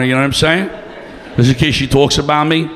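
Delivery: ordinary talk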